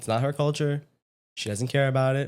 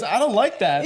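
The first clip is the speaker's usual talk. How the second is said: mock Sabrina; 8 semitones higher, 4 dB louder than usual. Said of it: "She goes".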